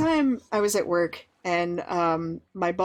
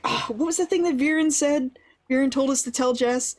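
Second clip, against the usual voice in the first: higher, animated mock exasperation; imitated exasperated recall; 5 semitones higher than usual. Boss asks coworker for the forgotten message, imitated exasperation. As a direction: act out grasping for a forgotten phrase, pitched up. Boss struggling to remember, acted.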